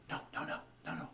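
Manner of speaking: whispered no, no, no, imitating a mother in the background; mother hissing at Santa from behind the boy, whispered; hushed no. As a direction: breathe it out under your voice, a hushed warning. Mother hushing in background of scene.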